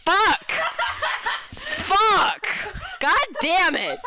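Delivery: loud, high laughing curses; frustrated shouting. Real, not acted. Player cursing at a game setback, loud and laughing.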